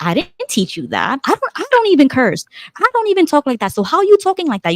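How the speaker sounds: high-pitched, indignant retold scolding; incredulous parent questioning a child; shocked parental scolding.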